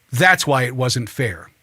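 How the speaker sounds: repeating the guest's verdict, low and slow; emphatic echo. Real, not acted. Echoing the guest's line with emphasis.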